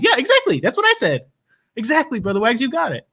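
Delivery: high-pitched, delighted agreement; emphatic agreement. Acted. Host confirming a chatter got it right, emphatic agreement.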